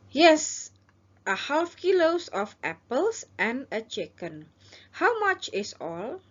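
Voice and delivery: slow, careful demo English; customer role. Shown as a demonstration. Teacher as shopper ordering apples and chicken, slow and clear. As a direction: spell the order out slowly for learners. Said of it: "ini adalah Anda".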